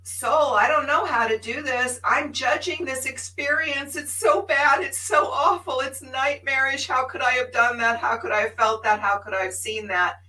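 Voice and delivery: high, loud, pleading distress; loud, high pleading lament; anguished plea to soul. Retold or imitated. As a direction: cry out to her soul in anguish. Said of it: "I said".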